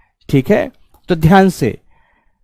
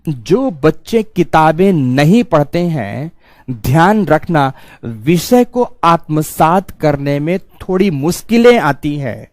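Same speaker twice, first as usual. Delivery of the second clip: slow, measured warning with "जो बच्चे" stretched out; slow emphatic repeat; slower than usual. Real, not acted. Teacher warning students who skip books, slow and deliberate. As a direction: let every word sink in, unhurried.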